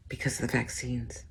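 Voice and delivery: unvoiced hushed whisper. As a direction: drop to a secret whisper. Whispered aside.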